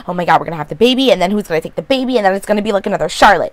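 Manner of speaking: fast panicky rush; freaking out. Retold or imitated. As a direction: blurt it all out fast in a panic. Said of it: "Allison started freaking out".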